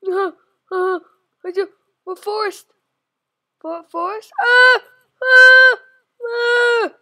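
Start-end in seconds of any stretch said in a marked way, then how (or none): 4.4-6.9 sharp high terrified scream; scream of fright. Real, not acted. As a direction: shriek at a jumpscare. Sudden scare.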